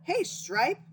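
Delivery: high, loud call across the pond; frog shouting. Acted. Frog calling out to the skunk, loud and high. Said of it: "shouted a frog".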